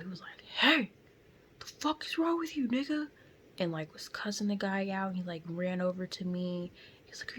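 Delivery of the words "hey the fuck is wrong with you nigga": high-pitched retold outrage; imitated freak-out. Bystander confronting the driver who hit her, imitated outrage. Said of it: "like, freaked out".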